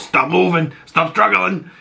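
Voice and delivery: hamming up Oz's threat. Retold or imitated. Fan quoting Oz's line to the camera, put-on villain voice.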